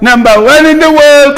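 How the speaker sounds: sneering singsong boast; mocking boast. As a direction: crow it in a high mocking voice. Mocking government's boast repeated.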